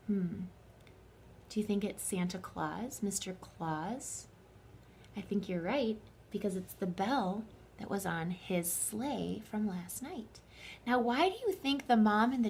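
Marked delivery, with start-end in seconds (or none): 0.1-10.4 hushed musing. A narrator's reading. Teacher hushes, musing with kids.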